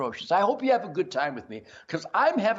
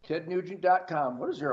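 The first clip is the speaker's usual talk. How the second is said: ordinary delivery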